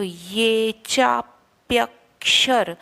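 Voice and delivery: slow ये चाप्यक्षर, ये with clear y; ye not e. Shown as a demonstration. Teacher correcting ये in the verse line. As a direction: begin ये with y, not ए. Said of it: "हम इसको ए उच्चारण नहीं करेंगे, ये करेंगे".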